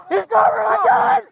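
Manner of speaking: high, panicked shout; alarmed shout. Real, not acted. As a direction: cry out in alarm. Gun grabbed in struggle.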